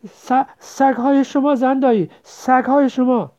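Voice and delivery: high, loud, slow plea; stammer, then call out about the dogs; scared calling. Acted. Child warning the wife about her dogs, scared calling.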